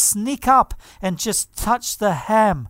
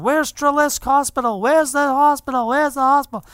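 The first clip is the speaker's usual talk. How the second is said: raised, urgent cry for directions; retold frantic shouting; 4 semitones higher than usual. Girl in a van asking for the hospital, frantic shouting. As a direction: imitate a frantic girl calling out, higher pitched. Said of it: "this girl's shouting".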